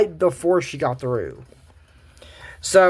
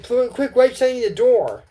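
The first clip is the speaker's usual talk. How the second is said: urgent call; 9 semitones higher than usual. Acted.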